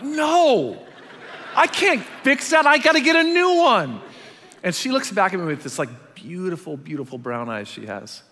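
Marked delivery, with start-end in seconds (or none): 0.0-4.0 high and loud, laughing whine; whiny protest. Retold or imitated. Husband whining to wife that he must get a new phone. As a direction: protest like a kid denied a toy. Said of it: "I'm like".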